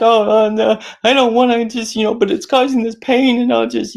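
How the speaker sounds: high whiny fretting about the dentist; whiny dread. Retold or imitated. Voicing someone dreading the dentist, high and whiny. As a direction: whimper like you dread the drill.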